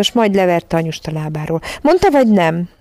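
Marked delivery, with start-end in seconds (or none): 1.8-2.7 higher, demanding question; insistent cry. A narrator's reading. Michael shakes his mother's skirt, insistent demanding question. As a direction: press for an answer in a mild shout. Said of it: "kiáltotta".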